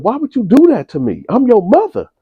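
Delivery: mock a horrified mother; distraught mother. Mother confronting son.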